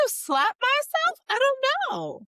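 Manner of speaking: high, slow, exasperated squeak. Retold or imitated. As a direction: throw up your hands, high. Frustrated rhetorical questions.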